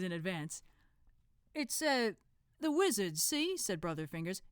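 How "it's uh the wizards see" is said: high, thick Cockney voice; a cheeky Cockney lad; Cockney accent. Acted. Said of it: "this guy's also got a thick Cockney accent".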